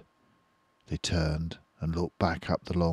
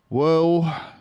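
thoughtful; 11 semitones higher, 8 dB louder than usual. Acted. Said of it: "he thought".

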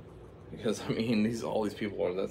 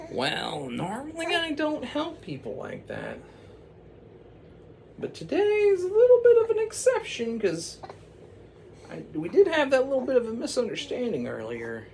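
very high, louder falsetto; high witch falsetto; 5 dB louder than usual. Acted. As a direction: play the witch high and grand. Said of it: "she was kinda like".

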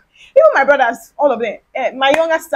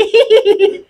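high, pitched-up mock giggling; imitated class laughter; 9 semitones higher, 4 dB louder than usual. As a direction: mimic a roomful of laughing classmates. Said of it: "everybody now start laughing".

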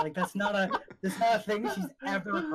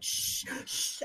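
soft, voiceless shushing; long soothing shushes; hushing; 5 dB quieter than usual. Acted. Attacker hushes woman being choked out, soft shushing. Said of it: "you're like".

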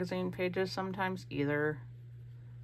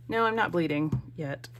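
ordinary talk

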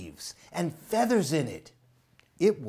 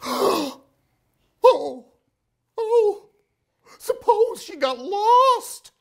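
high, louder fearful cry; big sister owl afraid mother is lost; frightened big sister owl; 6 dB louder, slower than usual. Acted. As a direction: voice rising in panic at the thought. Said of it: "said Sarah".

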